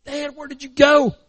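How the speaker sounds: high, anguished call; desperate plea. Acted. Struggling boy under water to his dad, desperate. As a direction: cry out for someone who has gone.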